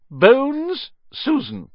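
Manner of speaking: surname high and loud, first name dropping; projected, drawn-out surname call; roll call. A narrator's reading. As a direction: call out the next name on the list. Teacher calls the roll.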